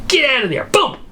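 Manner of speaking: high, sudden mock alarm; urgent cry without extra volume; urgent reflex. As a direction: act out a jolt, pitch shooting up. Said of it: "That reflex arc goes".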